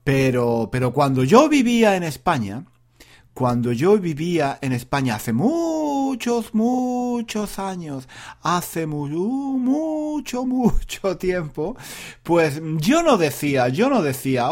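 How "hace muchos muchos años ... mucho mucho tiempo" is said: MUCHOS and MUCHO stretched long and high; slow, high, drawn-out stress on "muchos" and "mucho"; drawn-out emphasis. Real, not acted. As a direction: drag out each "mucho" at raised pitch. Exaggerates how long ago it was.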